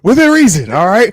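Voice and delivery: loud, high mock-stern warning. Co-host warning the chat to behave, mock stern.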